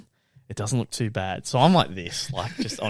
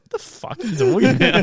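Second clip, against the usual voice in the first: higher, louder, baffled outburst; can't believe what he just heard; shocked disbelief; 11 semitones higher, 6 dB louder than usual. Retold or imitated. Dealer hears the kid's question, higher and baffled.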